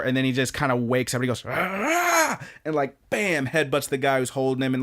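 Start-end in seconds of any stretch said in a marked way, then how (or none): 1.5-2.4 high-pitched rousing yell; imitated battle yell. Beaten man waking up to fight back, yelling. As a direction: burst out with a fighter's cry.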